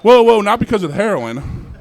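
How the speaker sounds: laughing loud whoa; loud laughing protest. Real, not acted. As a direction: shout over them, cracking up. Stopping crowd reaction, loud.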